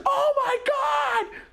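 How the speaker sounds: very high shriek of shock; screaming. Retold or imitated. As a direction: sister shrieking at the light in the sky. Sister screams at the UFO.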